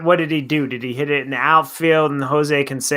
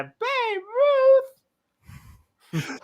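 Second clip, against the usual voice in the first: high called name. Pretend. Calling the name again.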